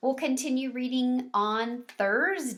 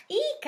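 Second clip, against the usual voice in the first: high startled eek; a tiny frightened squeak; little mouse eek, way up high. A narrator's reading. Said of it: "I squeaked".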